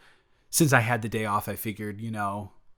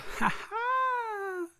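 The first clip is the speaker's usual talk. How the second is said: high squeaky rapper laugh, imitated; 4 dB quieter than usual.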